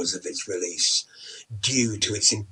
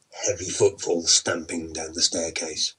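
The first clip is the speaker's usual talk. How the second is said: plain reading voice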